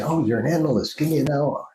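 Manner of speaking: normal talking voice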